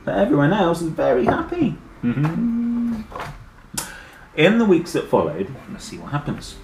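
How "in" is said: usual speaking voice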